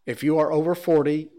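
ordinary talk, nothing marked

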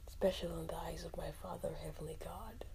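usual speaking voice

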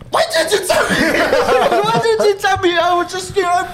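squeaky, very high mock protest; imitating indignant mum. Mum scolds son over rugby star, high mock indignation. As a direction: shoot the voice right up into a comic wail. Mum's outburst acted in story.

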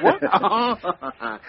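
a short laughing what. Acted. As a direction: ask it through a laugh. Teasing exchange, laughter between detectives.

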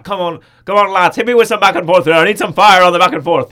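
put-on Colin Farrell's Irish accent, shouted and hearty. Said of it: "my Irish accent coming back there".

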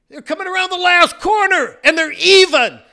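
loud, high retold commentary; thrilled race call. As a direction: shout the neck-and-neck finish to the room. Retold call of last corner.

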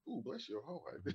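sweet fake consolation; hushed mock sympathy. A Southern woman softening bad news. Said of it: "So they're like".

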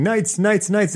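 high-pitched mock chant; imitated cheering. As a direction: imitate a winning team's chant without really yelling. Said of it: "they were cheering".

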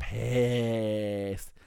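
"pissed" stretched very long; drawn-out emphasis. Real, not acted. Telling how angry overdrafted guests were, "pissed" drawn out. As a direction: hold the vowel of "pissed" for emphasis.

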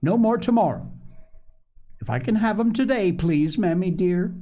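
put-on high, pleading child voice; little girl begging her mother for the shoes. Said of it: "cried Phronsie".

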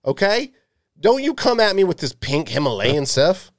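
high-pitched, fussy demand in mock outrage; mock indignant rant. Rejecting fancy salt as a demanding eater, mock indignant. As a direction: put on a worked-up customer's protest.

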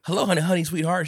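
high, loud mock-sweet phone hello; stupid sweetheart greeting. Agent faking a call to his sweetheart, mock sweetness. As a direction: gush it high, mocking a flustered husband. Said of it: "He says some, something stupid like that".

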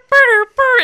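high, loud lip buzz; buzzing noise. Retold or imitated. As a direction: buzz right in their ear. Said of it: "get really close behind 'em and burr".

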